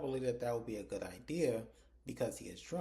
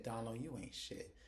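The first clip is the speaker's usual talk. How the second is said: scornful aside; 7 dB quieter than usual. Real, not acted. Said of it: "I said".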